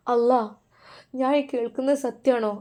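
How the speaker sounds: ordinary delivery, nothing marked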